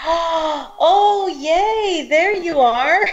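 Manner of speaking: high, gasping delight; thrilled he finally joined; excited greeting. Real, not acted. Guest finally connects.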